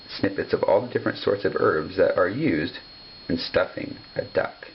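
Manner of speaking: ordinary delivery, nothing marked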